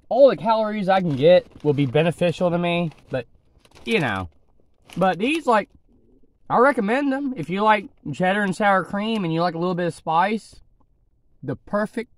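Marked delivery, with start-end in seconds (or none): 0.1-7.9 talking between bites; chewing while talking. Real, not acted. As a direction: mouth partly full of chips. Seen 3: Orange crumbs at the lips from 0.8 s; he chews between words.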